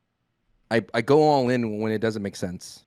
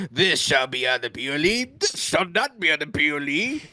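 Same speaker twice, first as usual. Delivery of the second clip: pompous decree voice; 3 semitones higher than usual. Pretend. Mocking a pompous gatekeeper decree.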